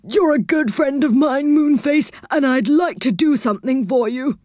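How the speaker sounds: high, put-on helpful character voice; Saucepan man. A narrator's reading. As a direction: offer help in a high character voice. Saucepan man's character voice in story.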